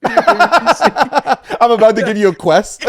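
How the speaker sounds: hammed-up NPC offering a quest; slow, laughing game-character voice; video game NPC. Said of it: "That's what you sound like".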